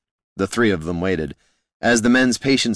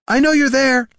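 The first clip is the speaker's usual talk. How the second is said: very high, loud shout into the woods; 6 dB louder than usual. A narrator's reading. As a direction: yell it at the hidden watcher. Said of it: "she shouted".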